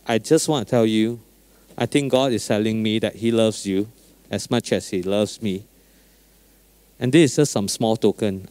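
soft, low, tender telling; gentle and sincere. Retold or imitated. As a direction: speak it softly like a kind pastor. Retold gentle words to driver.

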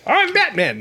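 high mock British Batman voice. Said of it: "Batman sound like that".

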